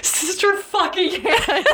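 high, slow, desperate wail; frantic cry. Acted. Reader voicing the wife crying to her sister in a high frantic wail. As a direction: stretch it out like she's losing it.

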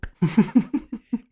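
bright rising chuckle; amused little laugh; short laugh. Real, not acted. Laugh at eating confession. (kurzes Lachen)

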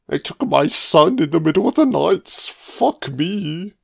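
lightly acted high-pitched distraught father; mock distraught father. Film viewer mimicking a man whose son was taken, mock distraught. Acts out the crazed man on screen.

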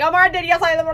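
high and loud with a thick Southern twang; excited Southern twang. Retold or imitated. Host showing how her accent sounds when excited. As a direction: blurt it out in a country accent, all excited. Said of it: "it gets strong ... when I get excited".

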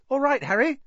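high-pitched starstruck fan; eager young fan. A narrator's reading. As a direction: greet your idol in a high, thrilled voice. Colin's eager greeting, acted.

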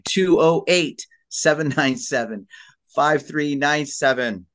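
slow, punched-out phone number read; radio host announcement. Real, not acted. Host repeats the guest's number for listeners, radio-host delivery. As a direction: announce each group of digits deliberately, radio-host style. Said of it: "I know I sound like a radio host".

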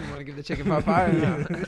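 laughing through the question. Retold or imitated. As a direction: giggle out the ask to the manager. Joking request to the manager.

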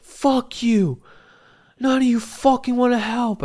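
high, loud acted cursing; angry shouting. Patient to staff over capacity, angry acted yelling. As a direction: imitate an outraged patient yelling.

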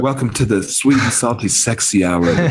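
low, sultry mock announcer; mock sensual voice. Friend mocks the other's sexy voice, low and sultry. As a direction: purr it out, teasing. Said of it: "like a sensual Fig voice".

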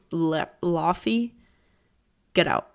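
normal talking voice

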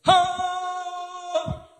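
high, long sung "ha"; imitated angelic choir note; angels' awed cry. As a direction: hold one high note like astonished angels. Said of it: "and the angels went".

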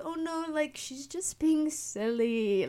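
calm them down like a sweet peacemaker; mock soothing. Speaker mediating the fight.

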